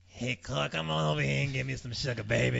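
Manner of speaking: boozy sugar lady. Retold or imitated. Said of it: "she'd be like".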